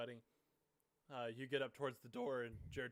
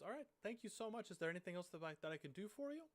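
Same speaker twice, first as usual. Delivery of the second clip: much higher, eager farm lady; lady's voice; 7 semitones higher, faster than usual. Acted. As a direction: brighten up high as the helpful lady. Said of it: "and she goes".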